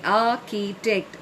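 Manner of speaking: architect split into ar, chi, tect; three syllables. Shown as a demonstration. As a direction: sound out architect, each syllable on its own. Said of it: "is again three syllables".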